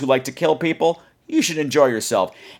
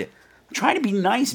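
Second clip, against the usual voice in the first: exasperated whine; 10 semitones higher, slower than usual. Real, not acted.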